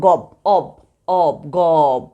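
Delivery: "গব, অব" spaced apart, the অব ending set apart clearly; sound out the syllables. Shown as a demonstration. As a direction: sound out "গব" and its অব part for the class. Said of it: "অব ধ্বনি উচ্চারিত হয়েছে". ("গব, অব" আলাদা করে ধ্বনি দেখানো)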